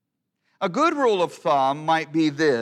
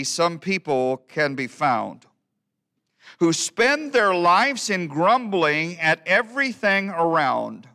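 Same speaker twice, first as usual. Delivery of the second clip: lilting, emphatic verse reading; poem recitation; 4 semitones higher, 5 dB louder than usual. Acted. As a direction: recite it with a bouncing, sing-song swing. Recites a humorous old hymn poem.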